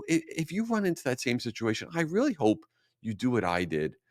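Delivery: normal talking voice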